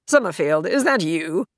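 loud, exasperated officer's demand. A narrator's reading. Officer calling missing cadet over comlink, exasperated. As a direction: snap it out loudly, with exasperation. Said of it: "exasperation in his voice was palpable".

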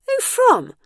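very high, loud, startled question; gasped. A narrator's reading. Bernice asks the tablet about the sender, astonished. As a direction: shoot the pitch right up in astonishment. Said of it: "she gasped".

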